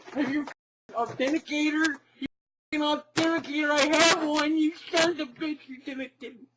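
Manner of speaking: outraged pitch climbing as he yells; furious rant. Acted. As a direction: rage at whoever hacked him. Yelling at Blizzard about authenticator.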